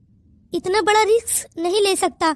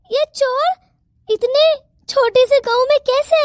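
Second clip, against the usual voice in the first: stunned disbelief; 6 semitones higher, slower than usual. Acted. Stunned by the theft.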